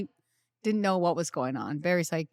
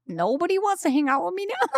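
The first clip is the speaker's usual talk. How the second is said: mock sulk; 11 semitones higher than usual. Child self in timeout.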